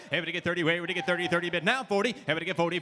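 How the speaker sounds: normal talking voice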